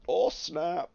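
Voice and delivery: European accent on a sudden exclamation; amused burst in a European accent; continental European accent. Real, not acted. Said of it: "talk what I perceive to be in a European accent".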